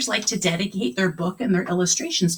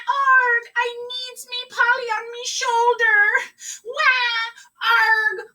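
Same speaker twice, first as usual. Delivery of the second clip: much higher, mock sobbing pirate; hammed-up pirate wailing; 14 semitones higher than usual. Pirate driver sobs after parrot flies out the window. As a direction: wail for the lost parrot.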